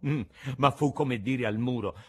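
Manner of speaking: usual speaking voice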